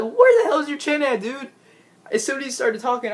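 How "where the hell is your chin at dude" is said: mock disbelief. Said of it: "I'm like".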